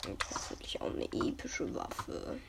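soft hopeful whisper. Real, not acted. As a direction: breathe it as a whisper. Whispered hope in game.

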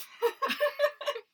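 high airy giggle; breathy laugh. Real, not acted. Laugh after whisper.

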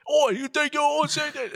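put-on high-pitched, garbled British accent; thick British accent. Drunk passenger before headbutting attendant, thick mock British accent. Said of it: "like, a thick ass accent".

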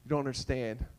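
low, softened voice of forgiveness; reassuring Jesus. Retold or imitated. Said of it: "Jesus is still like".

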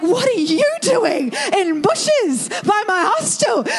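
half-shouted disbelief at her dad; retold exclaim. Daughter catching her dad hiding in bushes, incredulous half-shout.

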